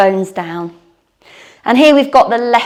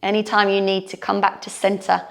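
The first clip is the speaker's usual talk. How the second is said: guided meditation; 3 semitones lower, 7 dB quieter than usual. Shown as a demonstration. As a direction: settle them into calm closing. Closing relaxation of yoga class.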